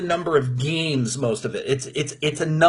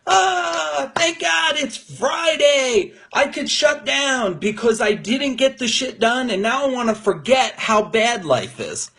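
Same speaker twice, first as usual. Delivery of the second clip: high, slow mock sigh of relief; sarcastic impression of the Friday crowd; mock relieved worker; 6 semitones higher than usual. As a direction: ham up a weary office worker thanking God for Friday. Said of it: "They're like".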